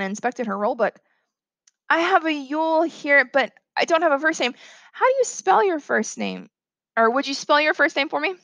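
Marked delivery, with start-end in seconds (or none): 1.9-8.3 higher-pitched, careful teacher voice; polite schoolteacher. Acted. Teacher asks pupil to spell his name, polite high voice. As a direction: ask politely in a raised pitch.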